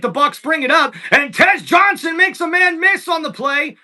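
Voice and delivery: steady broadcast play-by-play. Acted. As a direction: call the next play like a broadcaster. Resumes play-by-play.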